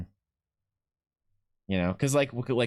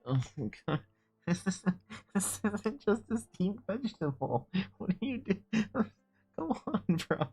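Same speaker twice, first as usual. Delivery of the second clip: high, giggly disbelief at the retold dinner; giggling at his own food ordeal; laughing disbelief; 9 semitones higher, 5 dB quieter than usual. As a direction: laugh through the complaint, mock outrage. Retelling a bad dinner, laughing.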